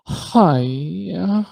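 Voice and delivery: gritty drawn-out hi-ya, imitated; gritty, dragged out. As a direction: drag it out with grit, rising. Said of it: "like, gritty kinda".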